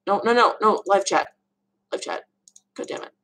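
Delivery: usual speaking voice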